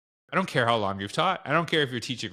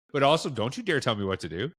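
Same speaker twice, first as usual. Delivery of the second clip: ordinary talk, nothing marked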